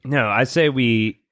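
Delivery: ordinary talk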